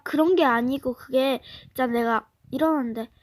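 ordinary talk

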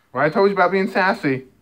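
a light pet-owner scolding; mock scolding. Real, not acted. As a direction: tell the cat off playfully. Playfully scolding the cat.

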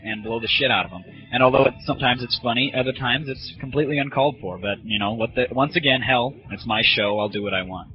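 ordinary talk

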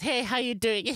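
high-pitched South African character greeting; cheerful South African accent voice. Retold or imitated. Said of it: "I'm doing the squint eyes".

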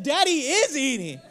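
words broken by laughter; can't stop laughing; laughing through it. Real, not acted. Dad laughs while retelling his protest to his son.

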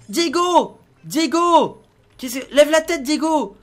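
high, loud drawn-out name; calling out. Pretend. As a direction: keep calling until the child looks up. Calls to his son. (appels criés, prénom étiré)

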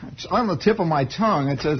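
ordinary talk, nothing marked

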